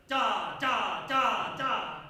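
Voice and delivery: loud, forceful sustained das; popped hits. Shown as a demonstration. Conductor demonstrates fortissimo hits to brass, loudly popped. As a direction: belt each da as a separate hit. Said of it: "So it's gonna sound like".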